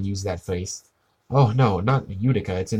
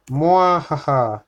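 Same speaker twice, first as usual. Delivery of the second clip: slow, high, booming evil cackle; evil villain laugh; 5 semitones higher, 4 dB louder, slower than usual. Pretend. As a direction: gloat like a cartoon villain. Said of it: "keep this stream going forever".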